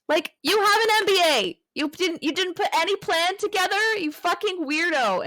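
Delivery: loud, high, incredulous outburst; bursting out in disbelief at her; exasperated rant. Real, not acted. Host ranting at Lily's planless business idea, loud and incredulous. Ranting at character's bad plan.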